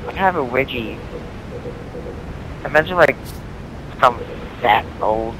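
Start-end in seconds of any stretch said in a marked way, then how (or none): none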